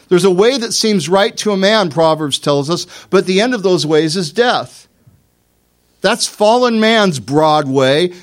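loud, high emphatic sermon voice; preacher at full volume; full-force preaching. Acted. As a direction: drive the point home from the pulpit.

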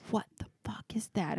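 whispered frightened question; hushed whisper in the dark bedroom; scared whisper. Retold or imitated. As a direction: whisper so nobody outside hears. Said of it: "And I'm like".

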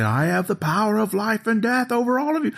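high-pitched imitation of a screaming villain; mock scream. Re-enacting Hindle's cliffhanger close-up, high mock scream. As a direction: overact the threat high, without real volume. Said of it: "Screaming like".

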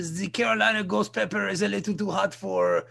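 fussy French waiter; put-on comic French accent. French waiter warning about the hot pepper, French accent. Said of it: "That's my French accent".

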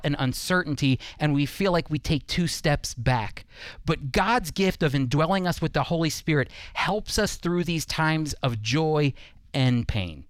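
normal talking voice

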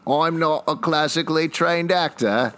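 high-pitched, hammed-up Australian accent; mock Australian accent. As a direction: parrot it back in a badly faked Australian accent. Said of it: "let's hear your Australian accent".

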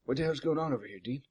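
hushed question; quiet. Acted. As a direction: keep it low and quiet. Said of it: "all quiet like".

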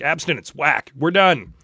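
loud, slowing on the last words; hammed-up stern lecture, drawn out at the end; mock strict teacher. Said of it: "they were just like".

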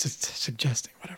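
unvoiced trailing whisper. Real, not acted. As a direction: let it fade to a whisper.

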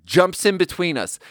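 ordinary delivery

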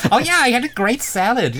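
high, laughing American lady voice; American accent. Acted. American voice for salad.

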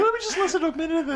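high mock-mom voice, laughing; imitated mother. Co-host playing the mother deciding to listen, high mimicry. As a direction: put on a high, quick mom voice.

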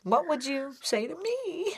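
ordinary talk

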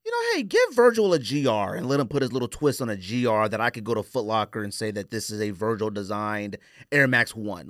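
usual speaking voice

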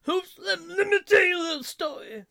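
high, loud, cracking wail of a speech; Bobcat Goldthwait rant. Retold or imitated. Imitating Bobcat telling a sad story, loud and cracking. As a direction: impersonate Bobcat's strained screeching storytelling. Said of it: "he goes into the whole speech of".

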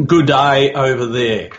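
slow, drawn-out mock Australian greeting; an American's overdone attempt at an Australian accent; bad Australian accent. Australian guest greets his American host by mimicking American impersonators, in a bad Australian accent. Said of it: "my American impersonation of bad Australian accents".

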